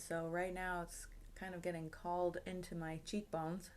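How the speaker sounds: ordinary talk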